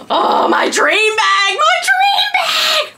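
high, slow cackling witch; drawn-out hag screech, very high; old witch voice. Acted. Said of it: "I sound like an old witch".

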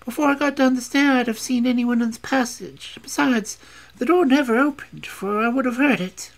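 high, quick, insistent servant voice; a young servant protesting to Holmes, pitched high; anxious young servant. Acted. Servant insisting to the detective that nobody passed, high and urgent.

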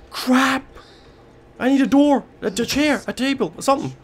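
high, loud frantic shouting; panicked yell. Real, not acted. Chased through the house, yelling in panic. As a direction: scream for anything to help.

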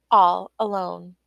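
ordinary delivery, nothing marked

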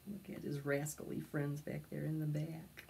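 ordinary delivery, nothing marked

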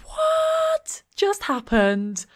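very high, drawn-out shriek of disbelief; shocked. Real, not acted. Player stunned by what just happened, high shocked shriek. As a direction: squeal it out in shock, stretching the words.

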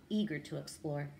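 plain reading voice

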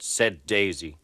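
ordinary delivery

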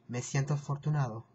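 "Me siento afortunado" run off quickly at natural speed; fast Spanish. Shown as a demonstration. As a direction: rattle off "Me siento afortunado" like a native. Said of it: "you can also say".

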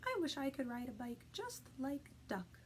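small, hushed mouse voice; mouse's wishful thought. Acted. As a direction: wish it softly, almost whispered, as the mouse.

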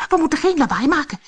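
high, quick, loud warning; fast, high-pitched character voice; urgent dwarf. A narrator's reading. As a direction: warn the others hurriedly as a little dwarf. Dwarf urges silence in character.

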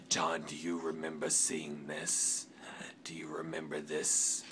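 soft, sly, whispered question; taunting whisper. Acted. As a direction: whisper it as a creepy reminder. Taunting rival with baton.

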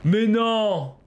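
loud, high burst of disbelief; disbelieving cry. Real, not acted. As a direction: cry out as if you can't believe it. Sudden discovery in the game.

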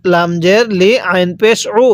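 ordinary delivery

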